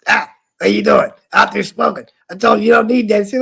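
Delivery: mock boss catching a smoker; imitating scolding boss. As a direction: nag him like a tiny fierce boss. Boss scolding him for smoking.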